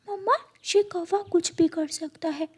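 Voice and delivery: put-on high, soft child bird; a small son warning his mother gently; little chick voice. Chick warns mother not to trust crow, high and soft.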